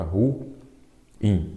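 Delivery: ruim split as "ru... im", long gap between; syllable by syllable. Shown as a demonstration. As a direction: break ruim into ru, pause, im. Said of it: "pode ser uma outra palavra". (ruim separado devagar em duas sílabas)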